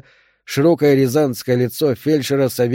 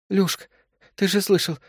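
high, thin plea through pain; pleading in pain; 7 semitones higher than usual. A narrator's reading. Woman in labour begs her husband to hurry, high pained plea. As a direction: beg him, voice pitched up high. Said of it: "взмолилась Вера и скривилась от боли".

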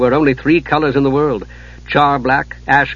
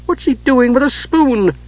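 bewildered; 11 semitones higher than usual. A narrator's reading.

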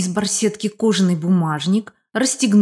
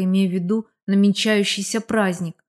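ordinary delivery, nothing marked

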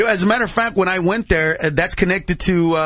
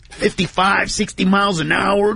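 ordinary delivery

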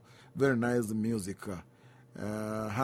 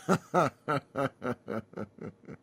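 laugh. Real, not acted. Laughs at own story.

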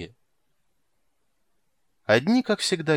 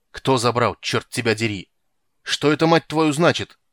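angry shout at the clerk; shout, grabbing him; furious shout; 7 dB louder than usual. A narrator's reading. Geralt grabs clerk furiously.

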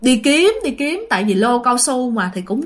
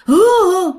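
sobbing sounds; 6 semitones higher, 5 dB louder than usual. Retold or imitated. Uncle sobbing at the grave.

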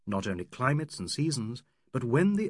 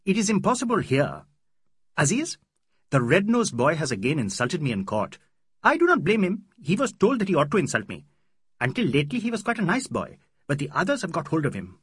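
higher, louder, quick indignant rant; a lawyer venting about an English boy; aggrieved complaint; 5 semitones higher, 7 dB louder than usual. Acted. Mahmud Ali rails about court insult.

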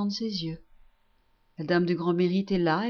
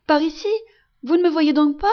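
higher and louder, urgent call; crying out; 6 semitones higher, 7 dB louder, faster than usual. Acted. Son calls his blind mother toward his bed, shout. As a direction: call again, pleading to be seen. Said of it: "crie le petit chose qui lui tend les bras en riant".

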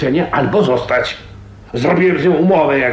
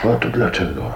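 cichy, pytający szept; 5 semitones lower, 3 dB quieter, faster than usual. Acted. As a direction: zapytaj szeptem. Pyta szeptem. (Abel szeptem pyta panienkę)

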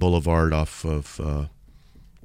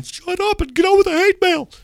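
very high, louder squeaky mock heckler; impatient audience; 6 dB louder than usual. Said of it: "people ... are going".

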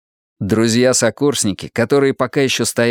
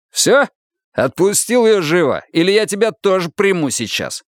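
angry threat; 8 semitones higher than usual. Acted. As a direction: threaten to arrest him too. Officer threatens Ilya.

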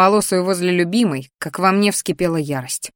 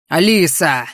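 growling; 3 semitones lower, 3 dB louder, slower than usual. A narrator's reading. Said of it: "зарычал Арагон".

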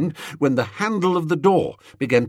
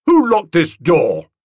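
loud; 5 semitones higher, 7 dB louder than usual. Acted. Tuppy shouting through locked door.

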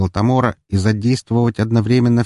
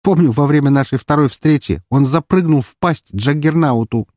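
higher, lively reminiscing; upbeat, raised-pitch boast; cheerful; 7 semitones higher, 4 dB louder than usual. Acted. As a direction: recall a wild exploit merrily. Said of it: "весело ответила Валькирия".

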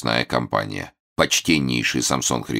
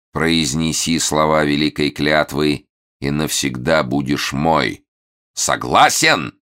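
barked demand; slower than usual. Acted. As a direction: bark the last word to echo. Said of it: "Момус рявкнул".

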